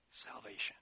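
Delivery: breathy whispered "salvation". Real, not acted. Preacher hushing the congregation on the key word, whispered.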